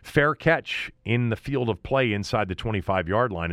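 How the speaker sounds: ordinary talk, nothing marked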